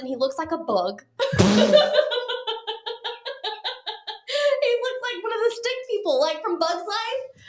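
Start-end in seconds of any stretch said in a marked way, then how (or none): 1.2-4.2 high burst of laughter. Real, not acted. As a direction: crack up at the thought of him. Laughs at date's looks.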